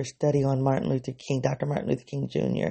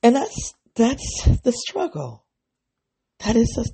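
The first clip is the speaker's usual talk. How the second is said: choked up; 8 semitones higher, 4 dB louder than usual. Real, not acted. Emotional about the struggle.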